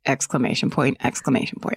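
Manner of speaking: fading to a whisper; dictating. Shown as a demonstration. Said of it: "in parentheses".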